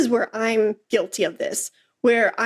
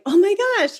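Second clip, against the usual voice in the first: overcome mother; 9 semitones higher than usual. Retold or imitated. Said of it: "her tears crying and going".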